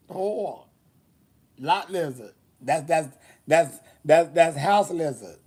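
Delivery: normal talking voice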